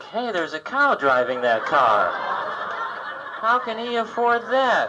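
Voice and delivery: hammed-up cow voice, rising high; cow's thoughts. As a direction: play the puzzled cow. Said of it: "we expect that cow to think".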